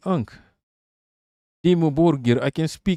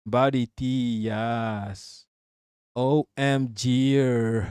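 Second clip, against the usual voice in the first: drawn-out parody of Gen Z slang; mock Gen Z talk; much slower than usual. Streamer showing viewers how to speak Gen Z, drawn-out mocking. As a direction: stretch out the slang in mock imitation. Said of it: "How to speak Gen Z?".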